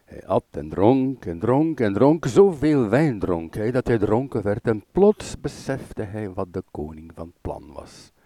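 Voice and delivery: usual speaking voice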